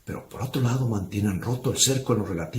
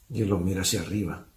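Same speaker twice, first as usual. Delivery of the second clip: ordinary talk, nothing marked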